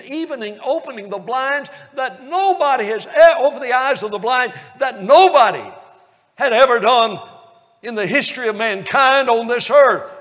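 rising revival preacher fervor; preaching at full force. Acted. Preacher stirring the congregation with rising wonder.

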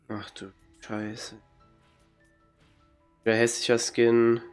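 ordinary talk, nothing marked

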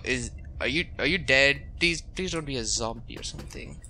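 ordinary talk, nothing marked

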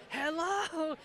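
high, drawn-out parrot hello; parrot getting insistent. Retold or imitated. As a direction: stretch it upward like a parrot calling. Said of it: "and it'd get more".

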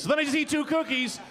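ordinary delivery

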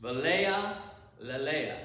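Velea lelea, dropping low on lelea; lovely Hebrew phrase. Shown as a demonstration. Teacher savoring the prettier Hebrew for the class. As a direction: say Velea lelea as something beautiful. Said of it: "that much prettier".

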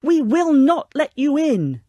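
slow, high, loud refusal; defiant yell. A narrator's reading. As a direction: hammer out the refusal, drawn out. Pigs keep yelling refusal.

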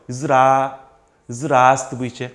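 Ist das Buche broken into very slow separate pieces; slow syllable-by-syllable teaching read; chopped into chunks. Shown as a demonstration. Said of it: "그럼 이렇게 한번 끊어보세요".

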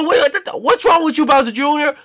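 high, loud outraged yell; indignant shout. Acted. Bowser Junior yelling back at the chef, outraged. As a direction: snap back at him in outrage.

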